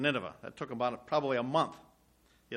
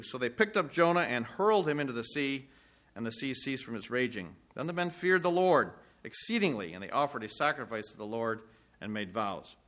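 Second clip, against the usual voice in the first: raised, measured reading of scripture to a congregation; 3 semitones higher, 5 dB louder than usual. Acted. Preacher reading Jonah to the congregation, proclaiming. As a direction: proclaim the story as you read it out.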